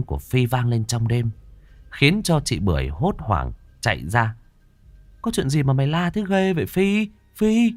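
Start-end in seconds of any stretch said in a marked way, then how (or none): 5.2-7.7 startled. Acted. Sister startled.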